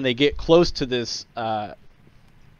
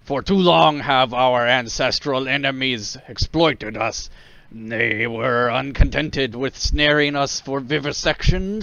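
rousing war speech; 3 semitones higher, 4 dB louder than usual. Acted. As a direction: stir up the crowd, rising. War leader rousing the crowd.

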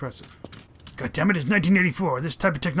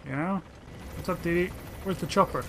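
normal talking voice

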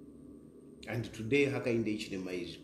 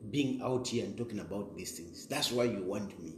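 ordinary talk, nothing marked

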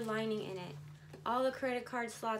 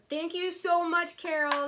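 higher, louder, gushing thank you; excited thanks; 6 semitones higher, 9 dB louder than usual. Real, not acted. Thanking Carol loudly in delight. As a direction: burst out with thanks.

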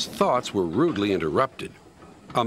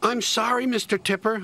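apologetic; 11 semitones higher than usual. A narrator's reading. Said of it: "apologized Percy".

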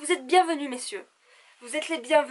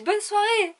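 bright brush-off; 8 semitones higher, 4 dB louder, slower than usual. Retold or imitated. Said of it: "j'ai dit".

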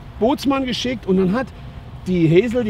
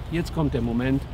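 calming down; 4 semitones lower, 6 dB quieter than usual. Shown as a demonstration. Trainer lets the calm set in, low and soft. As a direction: lower and softer, settling. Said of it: "jetzt kommt mein Moment".

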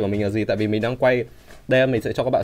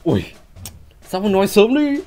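mock whine; 9 semitones higher, 6 dB louder than usual. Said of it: "mình cứ nghĩ là kiểu".

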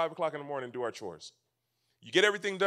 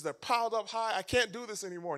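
higher-pitched imitation of exasperation; mock fussing; 5 semitones higher than usual. Wife fed up with piled dishes and laundry, mock fussing. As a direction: imitate a wife fussing, without raising volume. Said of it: "she's fussing about all these things".

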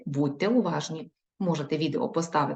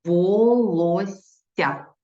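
Волосся drawn out even slower, every syllable apart; slow dictation repeat; 4 dB louder, much slower than usual. Shown as a demonstration. Teacher repeating for pupils, very slow. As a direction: spell out Волосся for writing. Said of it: "Наступне слово".

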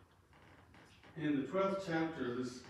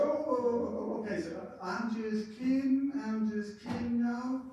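hasty backpedal; 9 semitones higher, 5 dB louder, slower than usual. Retold or imitated. Hastily backpedals after the one-eye look.

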